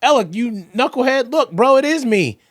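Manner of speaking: playful God calling him knucklehead; voicing God. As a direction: speak as the Lord scolding gently. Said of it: "I'm like".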